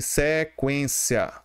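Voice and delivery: sequência drawn out slowly, syllable by syllable; teacher stretching sequência out slowly; slow word demo. Shown as a demonstration. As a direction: spell out sequência slowly for the class. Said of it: "Essa palavra aqui".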